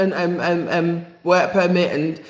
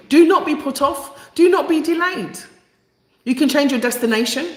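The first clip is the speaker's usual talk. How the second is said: urgent; 7 semitones higher than usual. Real, not acted. Urging viewers.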